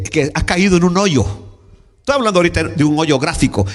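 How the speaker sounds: usual speaking voice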